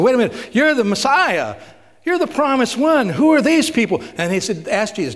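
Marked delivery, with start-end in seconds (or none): 0.0-4.0 high, worked-up protest; indignant outcry. Retold or imitated. Disciples protesting to Jesus about the town, worked up and high. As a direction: cry out in disbelief, pitch climbing.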